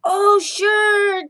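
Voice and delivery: high, loud call over the fence; eager cat calling out; shouting. Acted. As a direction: yell it across the yard. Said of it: "Chato shouted over the fence".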